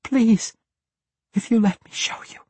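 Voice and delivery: very high, urgent plea; desperate pleading. A narrator's reading. Captive woman pleads with the assassin, desperate pleading. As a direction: beg in a high-pitched voice.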